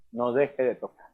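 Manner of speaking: low, soft divine voice; quiet, low command from above; voice of God. Retold or imitated. God telling a young man to keep knocking, hushed voice. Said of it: "él escuchó la voz de Dios diciéndole".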